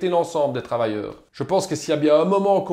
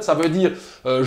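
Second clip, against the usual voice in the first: ordinary talk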